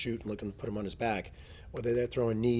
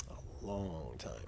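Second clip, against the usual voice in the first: LONG stretched out, slow and low; slow, low, lingering stress on LONG; drawn-out emphasis; 6 semitones lower, 9 dB quieter, much slower than usual. Real, not acted. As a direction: drag out LONG, trailing lower and softer. Drives home how long it is.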